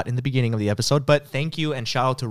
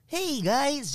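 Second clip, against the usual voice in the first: high chirpy vlogger voice; YouTuber greeting; 3 dB quieter than usual. Retold or imitated. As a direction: squeal it like a kid star. Mocking a Disney kid vlog greeting.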